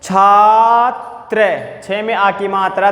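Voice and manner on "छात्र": छात्र stretched very slowly; teacher modelling छात्र slowly; drawn-out model word. Shown as a demonstration. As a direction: hold छात्र long for the class. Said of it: "जैसे पहला देखो".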